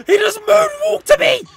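high, incredulous cry of disbelief; shocked cry. Real, not acted. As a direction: squeal it out in shock. Enemy moves strangely toward her.

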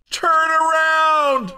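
high, drawn-out commentator's shout; calling out. Pretend. Announcer warning a racer going the wrong way, calling out. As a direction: holler a slow, high warning down the track.

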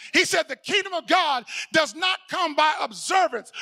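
full-force preaching. Acted. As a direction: drive the verse home to the congregation.

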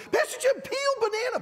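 put-on kids shouting. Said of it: "all the kids started yelling".

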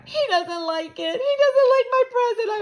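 high, loud tearful wail; hurt little boy whose gift was rejected; upset crying. A narrator's reading. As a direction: whine it on the verge of tears. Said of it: "Sam cried".

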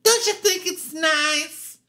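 high, slow, delighted imitation; Gladys Glover voice. Gladys admiring her billboard, high gushing voice. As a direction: gush proudly in a drawn-out high voice. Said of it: "she just walks around all the time looking at it".

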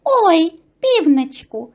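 high, drawn-out happy mouse cry; delighted. A narrator's reading. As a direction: greet the rooster with delight. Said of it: "радіють мишенята".

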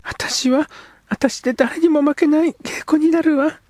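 put-on high girl's voice, earnest then shy; a girl shyly proposing a home together; young girl. Girl character speaks.

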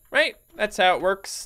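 ordinary talk

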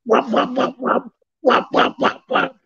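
high, soft "wop wop" fading to breath; imitated bite sounds. Describing the dog attacking, imitating the bites. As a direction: mimic quick biting hits.

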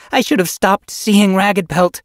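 loud, high pleading protest. Acted. Yellow Fang protests to Silver Flame about Ragged Pelt, loudly. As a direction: push back against her, raising your voice. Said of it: "Yellow Fang protested".

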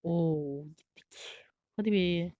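breathy whispered hadi be, barely voiced. Real, not acted. Gamer whispering to himself while waiting for ulti. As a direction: mutter hadi be under your breath.

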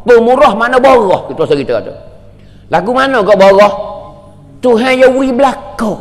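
ordinary delivery

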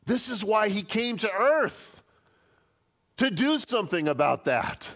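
high, loud urgent sermon voice; preaching at full force. Real, not acted. Preacher to congregation on God's anger at sin, fervent preaching. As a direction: drive it home from the pulpit.

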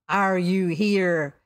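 loud, slow probing question; quizzing captain. A narrator's reading. Captain guesses at stranger's identity, probing. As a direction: boom the guess, stretching it out.

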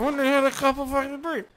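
put-on high, smeared drunken whine; drunk slur. Shown as a demonstration. Telling viewers what to say when pulled over, high drunken slur. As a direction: slur it all together high up. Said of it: "That's how you act drunk".

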